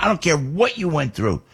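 ordinary talk, nothing marked

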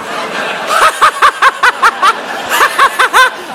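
high, hammed-up cackling fit; girlfriend's long helpless laugh, exaggerated; imitated mocking laughter. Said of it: "she's gonna laugh at you".

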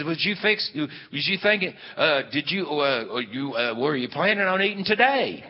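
flustered, stammering false starts; hammed-up tongue-tied invitation; fumbling suitor. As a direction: stumble into the question, then push it out high and loud. Retold suitor fumbles his invitation.

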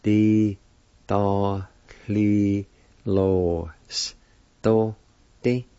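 Di, da, li, lo, s, do, di each said apart with pauses; teacher breaking a word into syllables; syllables one by one. Shown as a demonstration. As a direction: spell out Di da li lo s do di syllable by syllable. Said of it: "it's pronounced like this".

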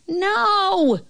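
high, stretched-out "no"; girl's high holler; shouting. A narrator's reading. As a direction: call the correction from far off. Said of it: "the girl's voice shouted".

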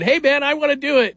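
pitched high, eager plea; keen rookie begging to be let in; eager young player. Retold or imitated. Top prospect asking the team to let him compete, eager. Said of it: "has said".

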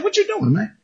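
mock bafflement at the rock; puzzled high question. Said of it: "I'm like".

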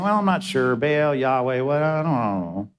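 mock indecisive waffling, sliding down low; imitating someone who can't make up their mind; wishy-washy. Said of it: "everyone kinda saying".

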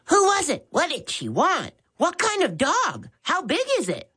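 put-on high, eager burst of kids' questions; excited rapid questions. The children pepper Max with questions, excited and high. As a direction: fire them off one after another. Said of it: "rapidly fired questions at him".

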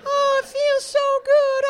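very high, slow, squeaky fake-moan voice; high falsetto parody of a satisfied wife; mock wife faking pleasure. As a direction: ham up a woman pretending it feels good. Mocking wife's fake pleasure.

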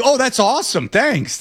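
high mock-grateful sarcasm; sarcastic. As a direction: gush it fake-sweet. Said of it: "I would be like".

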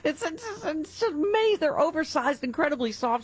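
very high, stammering excitement; gushing. Acted. Host overselling oversized beach towels, excited gushing. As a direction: trip over the words in high-pitched rapture.

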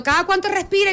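urgent brother. Retold or imitated. El hermano pregunta al teléfono.

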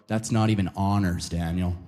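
low, dismissive mock voice; snooty friends. Friends mocking his seventy percent, low dismissive sneer. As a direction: drop low and sneer at the grade. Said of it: "they go".

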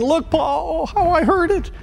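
put-on high little boy voice, pained and pleading; expressive suffering. A narrator's reading. Little boy to busy pastor father, high and pained. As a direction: play the hurt toddler showing his pinched finger. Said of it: "said with an expressive suffering".

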